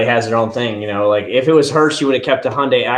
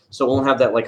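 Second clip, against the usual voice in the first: ordinary talk, nothing marked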